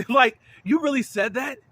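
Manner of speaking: high, loud incredulous laugh-question; laughing disbelief. Real, not acted. Outraged disbelief.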